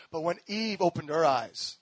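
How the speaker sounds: usual speaking voice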